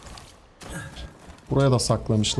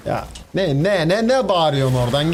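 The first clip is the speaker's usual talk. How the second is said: 1 mock yell; 4 semitones higher than usual. Yelling back at game enemy.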